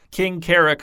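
ordinary delivery

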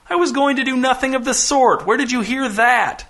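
high-pitched, indignant protest; indignant denial. A narrator's reading. Bound captain denies a plot to his crew, indignant and high. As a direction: deny it hotly, pitched well up.

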